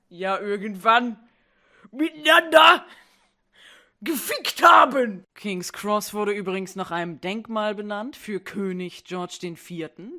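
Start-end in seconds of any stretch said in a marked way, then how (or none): 0.1-5.2 dramatic shout. Acted. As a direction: build it up, then blurt it out. Crude punchline, dramatic build.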